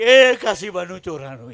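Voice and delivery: loud, stretched-out calling; mimicked shout. Man calling out a phone number, imitated shout. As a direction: call it out loudly like the man shouting. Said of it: "ઓલો કઈનું રાડું નાખે ને".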